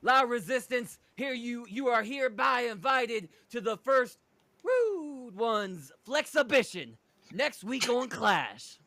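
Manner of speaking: high, slow announcer-style invitation; promo voice. Acted. [name] announces the Flexibition, grand promo voice. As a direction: stretch out the big invite. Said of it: "[name] picks up where his partner left off".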